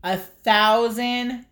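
drawn out, each word weighted; slow dramatic announcement. Real, not acted. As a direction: savor the big news slowly. Announcing the big milestone.

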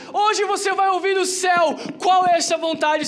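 loud, high preaching shout; preaching at full force. Real, not acted. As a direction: declare it to the crowd. Preaching climax.

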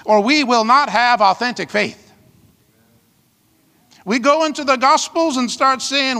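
usual speaking voice

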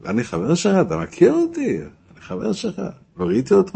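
high-pitched offended rabbi; hammed-up scandalized disbelief; mock indignation. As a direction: protest high, as if affronted. Mimics offended rabbi denying any friendship.

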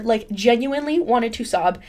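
ordinary delivery

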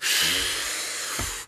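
long, low blown-out exhale; imitated mouth blow. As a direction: blow air out through the mouth, imitating his habit. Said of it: "he likes to blow out his mouth".

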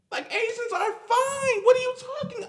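very high squeal of disbelief; exasperated outburst. Real, not acted. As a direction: shoot way up into an incredulous squeak. Exasperated outburst over skipped oppressed people.

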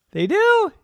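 sharp gasp then loud high squeak; gasp, then shriek it; shocked gasp and squeak. Acted.

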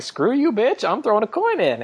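high, snotty mock Lizzie. A kid hurling a dare at her friend, high and snotty. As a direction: fling it at her like a bratty teen. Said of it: "Lizzie was like".

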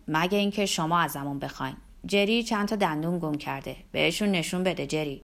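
put-on male voice, altered timbre; joking barroom man. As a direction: play a man ribbing his mate. First man answers, joking.

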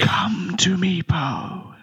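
slow, beckoning stage whisper; eerie whispered summons; whispering spirit voice. Acted. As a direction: lure them closer in a drawn-out whisper. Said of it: "you hear a whispering in your mind".

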